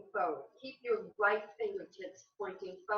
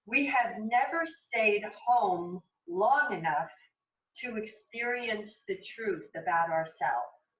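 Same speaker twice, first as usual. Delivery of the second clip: slow, louder, measured reading aloud; inspirational reading; 6 dB louder, slower than usual. Acted. Yoga teacher reads a meditation passage to her class, measured reading. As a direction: read it slowly and emphatically, letting it land.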